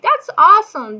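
high, loud burst of enthusiasm; retold delighted reply. Lonely woman finding a Portuguese speaker, retold excited cheer.

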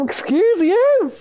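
very high, drawn-out indignation; mock affronted. Dumped dater answering his message, hammed-up affront.

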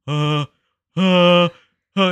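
loud, high panicked uh-uh-uh; nervous stammer. Retold or imitated. Nervous student stalling, retold scene.

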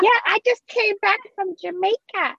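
high, drawn-out mock girl voice.

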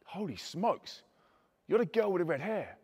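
hushed, amazed realisation; acted quiet astonishment; stunned recognition. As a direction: let it dawn softly, astonished. Acts out suddenly recognising the girl.

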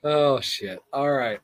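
ordinary talk

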